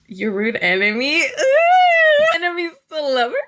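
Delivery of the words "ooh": very high, loud "ooh"; delighted high-pitched squeal; excited squeal. Real, not acted. Excited reaction to trope.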